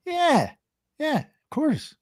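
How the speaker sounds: very high, breezy yes; cocky stepdad. Retold or imitated. Stepdad assuring the customer, very high and breezy. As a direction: toss it off like it's nothing. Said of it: "He's like".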